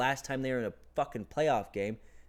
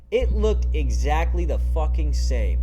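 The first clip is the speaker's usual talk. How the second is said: frustrated loud rant; 3 semitones higher, 9 dB louder than usual. Real, not acted. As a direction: let the frustration blow up loud. Fan rant about the offense.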